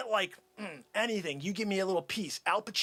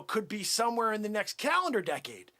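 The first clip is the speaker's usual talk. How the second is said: ordinary talk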